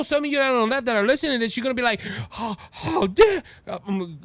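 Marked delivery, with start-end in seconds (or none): 2.3-3.4 higher, drawn-out mock outrage; slow, scandalized mimicry; Greta Thunberg. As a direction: gasp it out in indignant imitation. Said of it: "uh, Grinberg".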